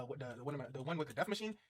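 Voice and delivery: normal talking voice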